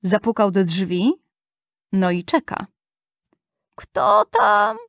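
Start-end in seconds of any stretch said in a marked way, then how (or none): 3.8-4.8 high-pitched granny calling from her bed; grandmother calling out. A narrator's reading. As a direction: call out as an old granny, high. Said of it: "tak z łóżka babcia woła". (wysoki głos wołającej babci)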